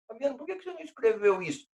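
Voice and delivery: quick high teasing voice; mock student question. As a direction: play the puzzled student.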